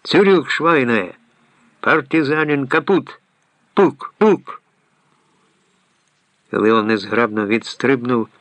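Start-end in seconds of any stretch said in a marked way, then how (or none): 0.0-4.6 high-pitched mock German soldier shouts; yelling. A narrator's reading. Hans playing soldier to frighten Leon, yelling. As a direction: holler like a play-acted wartime ambush. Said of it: "Ганс зарепетував на всю горлянку".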